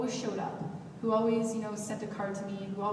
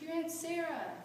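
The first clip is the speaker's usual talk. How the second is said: high mock-tearful reply; retold bawling; 8 semitones higher than usual. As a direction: imitate a distraught grandmother, pitched up. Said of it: "she's bawling".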